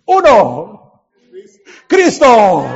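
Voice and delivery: ordinary talk, nothing marked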